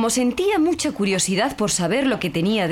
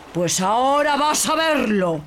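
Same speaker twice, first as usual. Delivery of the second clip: boastful genie; 3 semitones higher, slower than usual. Acted.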